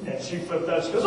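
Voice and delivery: normal talking voice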